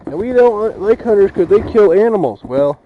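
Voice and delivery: loud indignant shouting; angry shout. Real, not acted. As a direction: hammer the point home. Ranting at event organizers.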